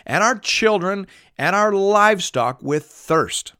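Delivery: high-pitched, accusing complaint; grumbling complaint. A narrator's reading. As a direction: read it as an aggrieved crowd accusing their leader. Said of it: "the people grumbled against Moses".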